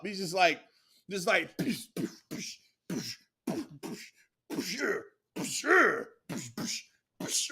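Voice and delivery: whispered push, push fading to breath; mock push sounds. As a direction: peter out into soft push noises. Mimicking pushes, fading.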